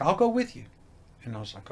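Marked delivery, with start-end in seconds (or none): 0.0-0.6 much higher, bright offer; a lightly voiced wife jumping in; high-pitched supportive wife; eager wife. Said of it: "And she's like".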